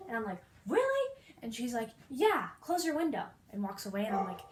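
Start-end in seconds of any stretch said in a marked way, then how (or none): 0.7-1.1 high, loud incredulous question; shocked. Retold or imitated. Hearing the captain's wife is dead, shocked. 2.1-3.2 curt maid. Retold or imitated. Maid's curt parting order.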